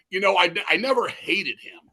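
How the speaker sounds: normal talking voice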